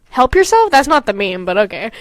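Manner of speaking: ordinary talk, nothing marked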